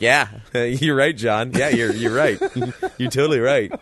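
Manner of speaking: a lightly voiced sheepish admission with laughs; mock sheepish agreement. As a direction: play someone grudgingly conceding the point. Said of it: "it's, it's like".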